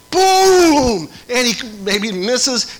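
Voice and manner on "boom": loud, higher-pitched "boom" burst; mock boom. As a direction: slam the sound out like a dunk. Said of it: "he goes".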